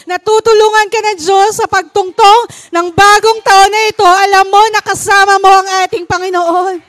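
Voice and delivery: high, loud exhortation to the faithful; fervent preacher building to a climax; preaching. Acted. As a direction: fire up the congregation. Exhorting congregation at full force.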